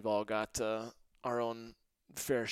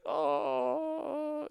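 pained groan. Retold or imitated. Injured player groans.

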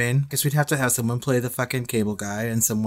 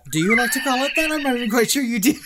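teasing mock voice; 10 semitones higher, faster than usual.

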